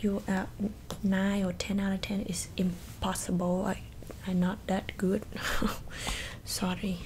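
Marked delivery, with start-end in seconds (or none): 5.3-6.4 soft laugh. Acted. Laughs after self-mockery.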